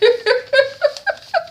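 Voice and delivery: high, long giggle; giggling laugh. Real, not acted. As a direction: break into a real high-pitched fit of giggles. Amused by something in the backyard.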